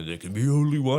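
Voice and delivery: high, loud movie villain quote; Highlander villain. Acted. Podcast guest quotes a Highlander villain to the host, hammed-up villain voice. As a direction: bellow the villain's famous line. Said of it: "same actor that does Highlander".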